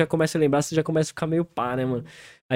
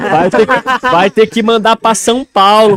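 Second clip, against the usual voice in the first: loud and high, half-laughing demand; bright playful hollering to a friend; excited loud joke; 6 semitones higher, 10 dB louder, slower than usual. Real, not acted. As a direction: shout it out playfully, drawn out. Joking demand after laugh.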